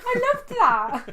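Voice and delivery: high, loud laugh through words. Real, not acted. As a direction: say it while cracking up. Laughing at own story.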